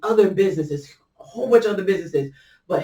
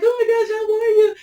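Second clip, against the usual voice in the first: high, fast, thrilled greeting; excited squeal; 4 dB louder, faster than usual. Retold or imitated. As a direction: squeal like meeting an old friend. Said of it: "start hugging and screaming".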